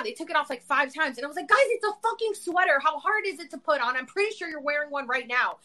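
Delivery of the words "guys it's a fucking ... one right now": mock exasperated snap, starting high; retold snapping. Patient to helpers struggling with a sweater, retold snap. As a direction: imitate losing patience, pitch easing down. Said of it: "I snapped at them".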